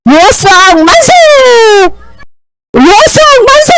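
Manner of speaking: high, rising hurrah; stretched, high-pitched acclamation; cheer for repeating. Shown as a demonstration. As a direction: lead the class in a jubilant chant. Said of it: "따라하자".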